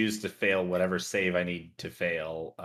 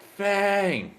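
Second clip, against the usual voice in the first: shout; 10 semitones higher, 5 dB louder than usual. Acted. Calling loudly for companion.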